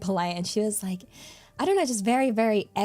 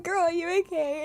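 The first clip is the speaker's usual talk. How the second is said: laughing concern; 8 semitones higher than usual. Retold or imitated. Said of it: "I was like".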